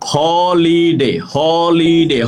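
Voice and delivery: "হলিডে" stretched out slowly with a clear opening h; slow teacher's model of "হলিডে", h fully voiced at the front; H pronounced. Shown as a demonstration. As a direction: draw out each syllable of "হলিডে", sounding the h. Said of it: "হ উচ্চারিত হয়েছে".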